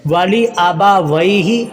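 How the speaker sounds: वाली आबा वही ही slowly, li short, 'way' a glide; correct careful recitation. Shown as a demonstration. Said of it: "ये अच्छी तरह लफ्ज़ समझ लें". (वाली आबा वही ही धीरे, साफ़ पढ़ें)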